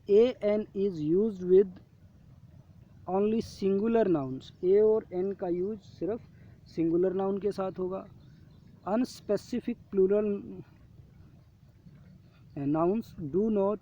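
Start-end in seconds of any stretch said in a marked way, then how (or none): 0.1-4.6 slow reading aloud. Shown as a demonstration. Reading worksheet rule. 8.9-13.8 slow reading aloud. Shown as a demonstration. Reading worksheet rule.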